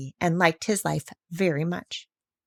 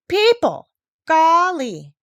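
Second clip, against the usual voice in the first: high, louder cry of disgust; exasperated exclamation; 8 semitones higher, 6 dB louder, much slower than usual. Acted. Parrot Polynesia fed up with people, exasperated exclamation. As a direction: throw up your wings at humankind.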